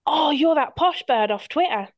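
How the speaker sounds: a light put-on Yorkshire accent, loud; mock thick Yorkshire accent, lightly done. Stranger at the airport greets her in a light Yorkshire accent. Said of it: "in a really thick Yorkshire accent".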